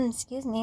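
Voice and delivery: clearing a sore throat. Real, not acted. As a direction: clear throat, then quiet apology. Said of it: "I'm losing my voice".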